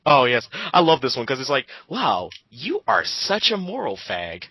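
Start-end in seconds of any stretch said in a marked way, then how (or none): none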